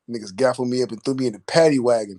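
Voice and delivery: usual speaking voice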